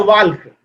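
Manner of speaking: "वाल्क" with the L sounded; mispronunciation. Shown as a demonstration. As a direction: voice the L before K in "वाल्क". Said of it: "वाल्क गलत है". ("वाल्क" में ल बोलते हुए)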